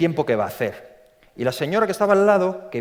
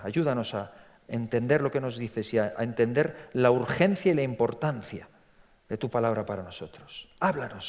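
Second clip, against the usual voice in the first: softer, hushed prayer voice; 5 semitones lower, 5 dB quieter than usual. Acted. Preacher praying over the congregation, softly. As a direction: pray quietly and earnestly to the Lord. Preacher prays before the sermon.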